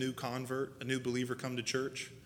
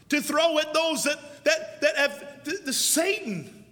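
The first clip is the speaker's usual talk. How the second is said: full-force preaching; 12 semitones higher, 10 dB louder than usual. Acted. Preacher calling the folks to testimony, full force. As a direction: preach it to the back row.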